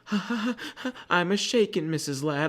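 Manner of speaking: high-pitched mock nervous panic; squeaky awkward laugh tumbling into words. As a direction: re-enact shaking stage fright, way up high. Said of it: "laughing, like".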